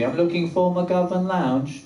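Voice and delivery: slow, badly faked British accent. Asking a lady on campus for directions, in a fake British accent. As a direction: stretch it out in put-on polite British. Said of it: "gonna use a British accent".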